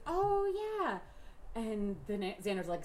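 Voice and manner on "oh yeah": high-pitched knowing "Oh, yeah"; Buffy's reply. Retold or imitated. Friend retells Buffy's coy admission, lightly voiced. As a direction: play Buffy coyly owning up. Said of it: "And Buffy goes".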